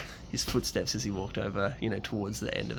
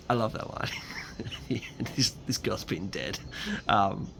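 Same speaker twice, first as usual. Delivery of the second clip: usual speaking voice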